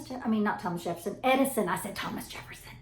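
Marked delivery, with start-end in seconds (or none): 1.7-2.8 whispered aside. Real, not acted. Muttering to self after misspeaking.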